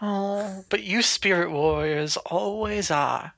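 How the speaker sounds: put-on a slow, soft southern accent. [name] re-taunting in her new southern accent. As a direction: draw it out southern and sly. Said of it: "she has a southern accent now".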